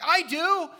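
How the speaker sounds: high, loud burst of mock incredulity; emphatic retort. Pastor answering a mourner's apology, emphatic loud retort.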